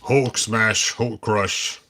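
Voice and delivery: slow, lightly growled monster brute; Hulk voice. Retold or imitated. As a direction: play the Hulk, slow and heavy. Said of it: "I think the Hulk would crush him".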